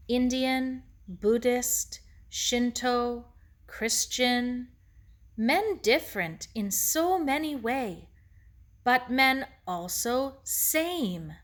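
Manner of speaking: put-on measured Japanese-accented sage, listing word by word; thoughtful Mister Ito. Ito teaches Sarah what all men share, thoughtful. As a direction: set each word apart, evenly weighted.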